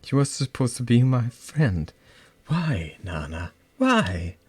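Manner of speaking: loud, anguished pleading; hurt, pleading cry. Acted. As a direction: cry out at the betrayal. Betrayed by best friend.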